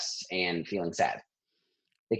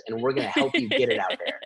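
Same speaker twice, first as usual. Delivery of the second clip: laughing; 13 semitones higher, 3 dB louder than usual. Retold or imitated. Friends cheering her on.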